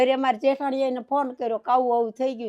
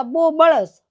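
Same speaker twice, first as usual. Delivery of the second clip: louder, raised cry of burning pain; retold cry of pain; 3 semitones higher, 7 dB louder, much slower than usual. Widow voicing her husband's cry of pain, louder. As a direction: voice grandfather's anguished complaint. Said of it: "હા, કહે છે".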